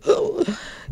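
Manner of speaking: sharp gasp for breath, caught by emotion; choking gasp. Retold or imitated. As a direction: gasp as if the air won't come. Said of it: "y me ahogaba". (jadeo ahogado de emoción)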